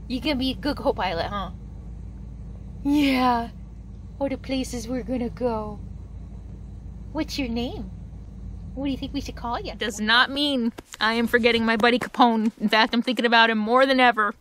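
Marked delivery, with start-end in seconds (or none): 0.1-9.7 soft puppy talk. Real, not acted. Murmuring to the puppy.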